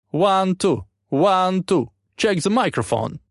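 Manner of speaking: loud, high rocker mic check. A narrator's reading. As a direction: call it out like a stage soundcheck. Frontman does mic check.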